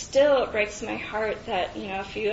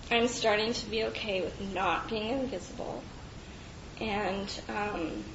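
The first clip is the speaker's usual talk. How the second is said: normal talking voice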